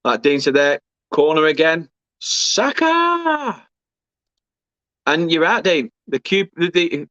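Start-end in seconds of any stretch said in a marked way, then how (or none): 2.2-3.7 high, drawn-out name call; joyful shout. Real, not acted. Celebrating scorer on replay.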